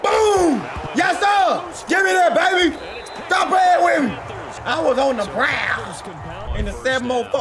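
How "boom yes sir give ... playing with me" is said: high, excited trash-talk shouts; hyped celebration. Real, not acted. Gamer telling opponent to stop playing with him, hyped shouting. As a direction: boast at full pitch.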